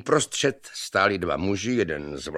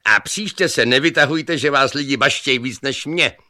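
loud, higher cocky telling-off; scolding; 7 semitones higher, 7 dB louder than usual. A narrator's reading. Long-haired youth warns his rival against boasting, scolding loudly. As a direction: call it out with irritation.

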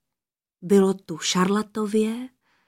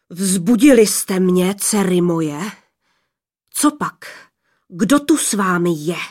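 menacing father; 2 semitones lower, 3 dB louder than usual. Acted. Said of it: "zněl hrozivě".

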